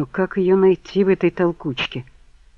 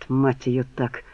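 ordinary delivery

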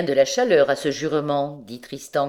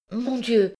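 high, mildly tearful cry; 7 semitones higher than usual. A narrator's reading. Recluse at bay before the provost, tearful exclamation. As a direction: plead as tears creep in against your will. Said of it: "d'une voix malgré elle pleine de larmes".

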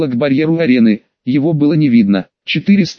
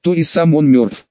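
ordinary delivery, nothing marked

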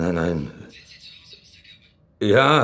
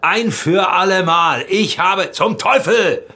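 much higher and louder, roaring every word; furious shouting; 9 semitones higher, 5 dB louder than usual. Acted. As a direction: blow up and bellow it out in rage. Furious outburst at partner's accusation.

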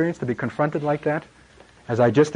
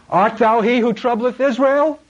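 higher, loud accusing challenge; accusing king; 9 semitones higher, 3 dB louder than usual. Retold or imitated. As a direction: throw the charge at the prophet. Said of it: "what Elijah heard".

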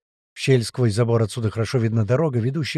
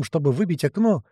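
plain reading voice

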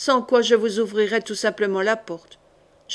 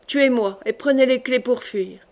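usual speaking voice

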